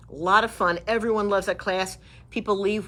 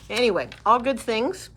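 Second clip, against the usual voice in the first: normal talking voice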